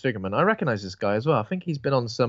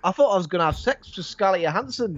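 hammed-up Northern English, pitched higher; a silly Northern bloke voice; playful put-on Northern twang; mock Northern accent; 5 semitones higher than usual. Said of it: "When I'm doing Northern".

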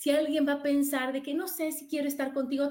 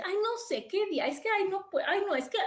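mock hesitant friend; 7 semitones higher than usual. Mimics friend dodging invitation.

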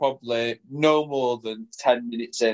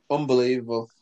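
usual speaking voice

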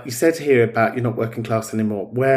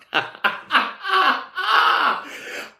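a high, long burst of laughter, trailing down; big laugh. Real, not acted. Host laughing at his own joke about Nana, high laugh. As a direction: let a real laugh break out and fall away.

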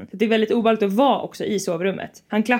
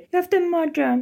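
retold phone call; 8 semitones higher than usual. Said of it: "Jag ringer till Henrik och säger".

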